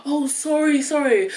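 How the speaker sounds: imitating locals backtracking in embarrassment; mock apology. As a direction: mimic them apologising in a rush. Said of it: "they're like".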